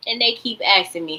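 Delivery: ordinary talk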